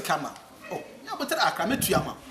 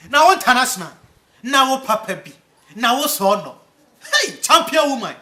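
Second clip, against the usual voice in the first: loud teasing call; 4 semitones higher, 11 dB louder than usual. Real, not acted.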